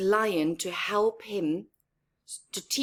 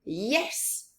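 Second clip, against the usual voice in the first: plain reading voice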